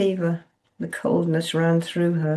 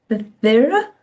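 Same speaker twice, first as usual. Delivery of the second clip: stammering; 6 semitones higher, 8 dB louder than usual. A narrator's reading. Dazed reply.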